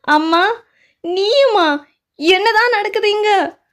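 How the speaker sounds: can't believe her own mother is in on it; shocked disbelief. Acted. Wife finds her mother is in on it, shocked high cry.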